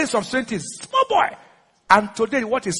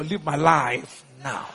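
slow, low, drawn-out order; measured command; 7 semitones lower, 5 dB quieter, slower than usual. Shown as a demonstration. Preacher ordering failure out of his life, slow low command. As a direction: lower the voice and stretch each word.

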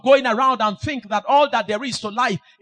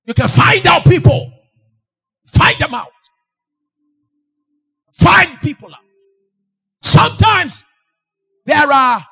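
high, loud preaching shout; full-force preaching; 6 semitones higher, 10 dB louder, slower than usual. Acted. Preacher shouting at the congregation. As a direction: thunder it at the crowd.

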